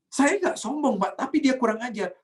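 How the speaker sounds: high-pitched defensive whine of denial; indignant protest. Acted. Acted indignant self-defence to pastor. (protes membela diri dengan nada tinggi)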